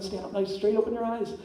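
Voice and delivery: ordinary delivery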